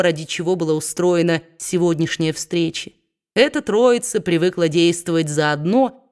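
ordinary delivery, nothing marked

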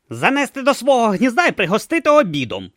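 high, loud snappish retort; snapping tortoise. Acted. Tortoise snaps at Brutha, loud and high. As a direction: bite back sarcastically. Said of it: "огризнулася черепаха".

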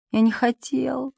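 soft, slow plaintive whisper. A narrator's reading. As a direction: whimper it softly and slowly. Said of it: "жалобным голосом шептал Префен".

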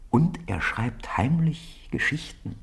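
slow, conspiratorial whisper. A narrator's reading. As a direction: let the secret slip out under your breath. Secret told in a whisper.

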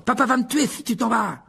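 high, frantic calling out; panicked high plea; shouted plea. A narrator's reading. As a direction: yell it desperately after a runaway. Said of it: "cria Ron".

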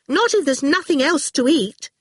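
high, worried objection; anxious. A narrator's reading. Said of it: "Orphle said anxiously".

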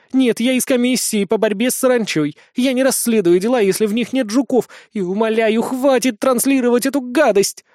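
high-pitched, harried begging; pleading. A narrator's reading. Witch begging the boy to stop the broadcast, pleading. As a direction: explain desperately, then implore him to stop. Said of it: "взмолилась ведьма".

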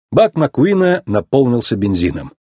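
ordinary delivery, nothing marked

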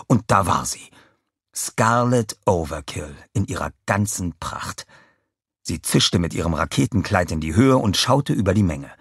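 ordinary delivery

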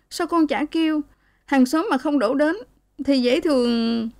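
put-on high, hurt young woman's protest; aggrieved daughter. A narrator's reading. Daughter protests to her mother, high and aggrieved. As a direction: insist you did cry out.

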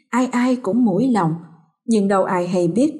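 ordinary delivery, nothing marked

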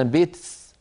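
"بيتس" ending in ts; Najdi kaskasa. Shown as a demonstration. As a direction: feminine k said as ts at the end of "بيتس". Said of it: "يقول هكذا بالسين".

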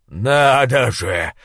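блеющий безумный трактирщик, медленно и громко; растянуто проблеять, как обезумевший толстяк; блеющий голос. A narrator's reading. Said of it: "проблеял он". (блеющий безумный голос)

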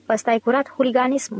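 ordinary delivery

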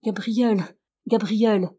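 faint, fading call with falling ends; weak calling voice. A narrator's reading. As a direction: feeble plea from inside, no force behind it. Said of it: "La voix faible appelait toujours". (appel faible, intonation descendante)

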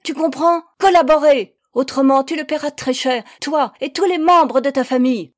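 loud, high menacing command; ferocious threat. A narrator's reading. Girl threatens the woman and her family, ferocious. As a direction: threaten fiercely at full volume. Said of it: "m'ordonna férocement la jeune fille".

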